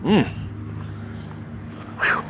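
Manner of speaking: ordinary talk, nothing marked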